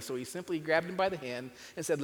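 ordinary delivery, nothing marked

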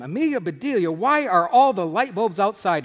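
mildly hammed-up indignant husband; indignant questioning. Employer questions housekeeper about the bulbs, indignant questioning. Reader acts Mister Rogers demanding explanation.

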